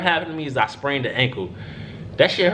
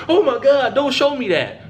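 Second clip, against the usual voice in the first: high-pitched, louder grossed-out imitation; mock recoil; 6 semitones higher, 3 dB louder than usual. Onlooker shown the finger, imitated disgusted recoil. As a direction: push the sight away, voice pitched up.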